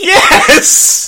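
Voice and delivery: loud, high laughing yes. Real, not acted. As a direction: whoop through laughter. Laughing at twist.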